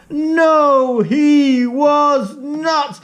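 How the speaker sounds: high and slow, spelling it out; emphatic storyteller. A narrator's reading. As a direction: draw each word out for the kids. Storyteller answering the children.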